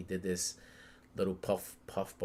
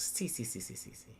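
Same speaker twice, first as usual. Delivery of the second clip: Luffy; 10 dB quieter than usual. Retold or imitated. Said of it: "Luffy's laughing".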